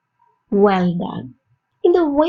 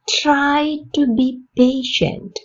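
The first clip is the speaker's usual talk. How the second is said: slow, clear repeat with a falling end; slow model repeat; 3 semitones higher, much slower than usual. Shown as a demonstration. Teacher repeating the sentence for learners, slow and clear. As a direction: say it again slowly, ending down. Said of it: "repeat for learners".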